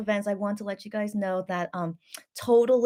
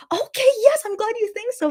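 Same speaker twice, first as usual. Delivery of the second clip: high, louder eager reply; excited; 13 semitones higher, 4 dB louder than usual. Retold or imitated. Daughter retelling her eager answer to mom, high and excited. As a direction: gush it back, pitched up. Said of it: "I'm like".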